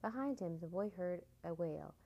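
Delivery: usual speaking voice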